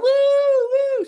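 very high mock whooping; drunken whooping girl. Pissed girl in front row, high whooping. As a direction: imitate a tipsy girl whooping. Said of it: "She's pissed".

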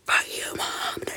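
hushed mock curse at mom; defiant whisper. Kid cursing mom at the closed door, defiant whisper. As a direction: whisper it hard but keep it secret. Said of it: "just, like, this whisper".

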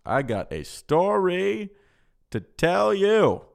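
"I got a story to tell you" stretched slow and high; drawn-out announcement. Pretend. Same words repeated as hammed-up announcement.

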